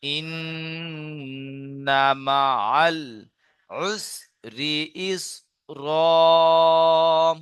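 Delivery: very slow melodic recitation; Quran recitation. Shown as a demonstration. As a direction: chant the verse, drawn out. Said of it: "যেমন".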